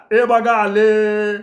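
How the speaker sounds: high, loud drawn-out call; shout. Acted. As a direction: yell to point someone out. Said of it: "एक जण ओरडला". (गर्दीतला ओरडणारा)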